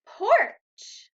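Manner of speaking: Porch said high and loud; bright model word. Shown as a demonstration. Teacher drills Porch for the class, high and loud.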